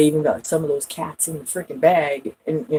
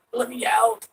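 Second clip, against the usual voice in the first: higher-pitched plea to be freed; acted plea; 7 semitones higher than usual. Voicing the trapped cat asking out, high contained plea. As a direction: plead to get out, raised pitch, not yet shouting.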